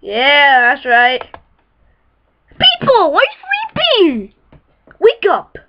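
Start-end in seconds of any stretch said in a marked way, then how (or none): 2.5-4.3 calling out high. Acted. Calling out to sleepers.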